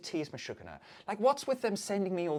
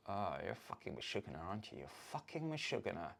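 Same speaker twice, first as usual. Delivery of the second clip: low, soft, fed-up grumble; exasperated; 9 semitones lower, 13 dB quieter than usual. Retold or imitated. A partner fed up with your madness, grumbling low. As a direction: mutter it like you're sick of them. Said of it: "as opposed to like".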